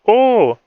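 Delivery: high, loud screamed "oh". A narrator's reading. Miller screamed throwing her spear, high scream. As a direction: cry out at a leaping high pitch. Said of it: "Miller screamed".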